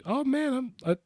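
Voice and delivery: high delighted "oh man"; excited. Retold or imitated. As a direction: gush with delight. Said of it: "I was just like".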